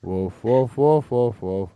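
low barking woofs; deep dog barks. Shown as a demonstration. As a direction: bark like a big dog, low. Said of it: "let's go a little bit lower again".

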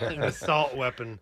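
a hint of a zombie; mock zombie line with laughter around it; joking zombie voice. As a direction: lightly mimic the letter writer as a zombie. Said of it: "writing you letters is a zombie".

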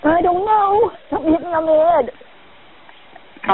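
real panic, high and breathless; startled and frightened, pitch jumping up; panicking. Real, not acted. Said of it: "I know I shouldn't be panicking".

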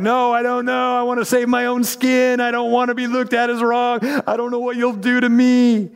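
high, pleading self-protective voice; frightened Peter. Retold or imitated. Peter explaining his fear, high and pleading. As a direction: sound scared of what they'll do. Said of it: "'Cause he was like".

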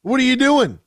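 loud, high, fired-up rant; heated rant. Real, not acted. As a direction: let it all out at him. Ranting at LeBron's antics.

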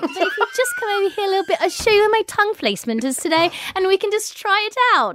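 high, playful put-on coaxing voice; high-pitched joking come-on; mock seductive wife. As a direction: ham up coaxing a husband. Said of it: "with you with that voice".

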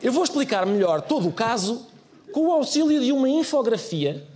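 ordinary talk, nothing marked

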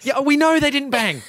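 high-pitched, exasperated comeback. Real, not acted. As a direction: shut down the repeated point, pitch way up. Exasperated at friend repeating himself.

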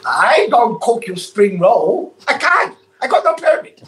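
slow mock Chinese accent, breaking into laughter; Chinese shopkeeper accent. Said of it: "I'm just talking like him".

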